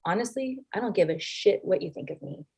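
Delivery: soft, unruffled retort; real calm. Retold or imitated. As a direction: say it quietly, completely unbothered. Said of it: "real calm".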